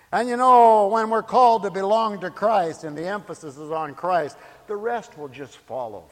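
loud preaching, easing as it goes; full-force preaching. Real, not acted. Preacher returning to belonging to Christ, loud and emphatic. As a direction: launch the point loudly to the congregation.

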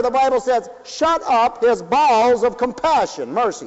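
preaching. Acted. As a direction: drive it home to the congregation. Preacher pressing questions.

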